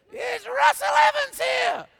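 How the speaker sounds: high, piercing, drawn-out shout; booming high call that cuts through the cinema; scary mother's voice. Acted. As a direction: shriek it like Satan in a horror movie. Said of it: "made Satan's voice sound like my mother".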